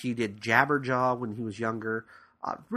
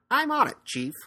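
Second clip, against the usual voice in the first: high-pitched, louder cartoon catchphrase; Inspector Gadget; 13 semitones higher, 4 dB louder than usual. Podcaster imitating a cartoon detective answering his boss, high put-on voice. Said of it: "you always heard Inspector Gadget".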